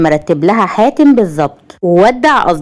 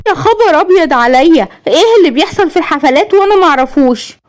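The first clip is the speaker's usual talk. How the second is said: high-pitched appalled wife; high, scandalised questioning; shocked indignation; 9 semitones higher than usual. A narrator's reading. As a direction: react in outrage, pitch way up. Wife shocked by husband's excuse.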